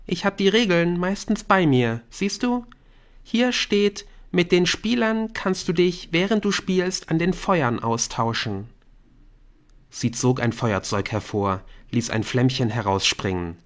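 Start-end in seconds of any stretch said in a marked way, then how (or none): 0.1-8.7 put-on high eager teen girl; eager explaining girl; Bryn voice. A narrator's reading. Bryn shows the rules.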